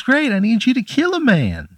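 squeaky high cartoon pickle calling out; little pickle shouting up from the plate; tiny pickle voice. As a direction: call up from below in a tiny squeaky voice. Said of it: "He goes".

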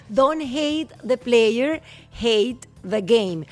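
Don't hate the player said very slowly, each word spaced; slow careful English. Shown as a demonstration. Repeating the phrase slowly for listeners.

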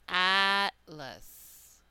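atlas with a long, full first A; demo pronunciation. Shown as a demonstration. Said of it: "the first A in atlas had a full, long pronunciation".

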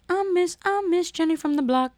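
high-pitched jeering imitation; mock her boast in a high voice; mocking pop star.

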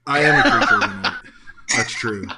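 fast, laughing through the words. Real, not acted. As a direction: blurt it out laughing. Laughing, fast mock admission.

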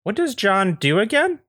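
high-pitched, nosy neighbor; Andy Daly. Acted. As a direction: probe under cover of folksy small talk. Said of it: "is played by Andy Daly".

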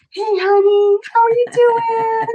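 very high, loud sing-song sweet talk; lovey-dovey husband. Retold or imitated. Husband alone on the phone to his wife, exaggerated high-pitched cooing. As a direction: coo it in a hammed-up high voice. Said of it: "this is how I knew he was alone".

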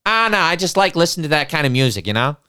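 lightly voiced Boston local; Boston accent. Man in the back explaining his taste, loud Boston accent. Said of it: "in a very thick Boston accent".

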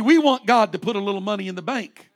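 ordinary delivery

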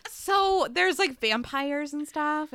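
clueless question. Retold or imitated. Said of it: "and I'm like".

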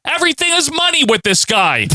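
high-pitched announcer impression; imitating Todd. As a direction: mimic a ringside announcer up high. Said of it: "Then Todd Einstein over here".

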